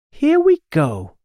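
high, excited send-off; eager launch. A narrator's reading. Witch mounting her broom, high and eager. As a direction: announce take-off with a high lift.

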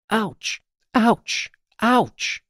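high, short yelps of mock pain; acted pain. A narrator's reading. Witch's head bumping trees and roofs, high yelps. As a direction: yelp at each bump, high-pitched.

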